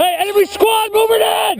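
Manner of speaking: urgent shout. Real, not acted. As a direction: warn the squad. Warning of enemy squad.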